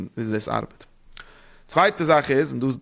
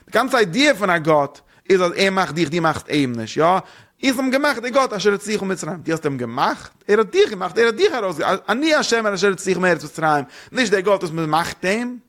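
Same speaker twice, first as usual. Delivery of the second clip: preaching at full force; 6 semitones higher than usual. Acted.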